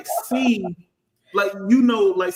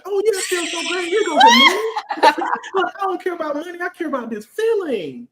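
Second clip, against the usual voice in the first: much higher, fast, laughing shout; mock giddy dupe; 11 semitones higher, 3 dB louder than usual. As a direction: gush like someone handing over money happily. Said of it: "You be like".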